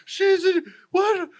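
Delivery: horrified partner reacting to the bird; shocked. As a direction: squeal it in disbelief. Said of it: "Micah's like".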